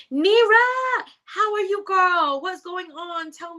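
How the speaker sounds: high-pitched, loud squeal of welcome; excited greeting. Real, not acted. Host spotting a friend in the chat, excited greeting. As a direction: shriek the name, then gush. Greeting a viewer who just arrived.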